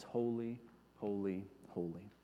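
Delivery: hushed, descending echo; reverent fade. Acted. Reverent repetition of praise.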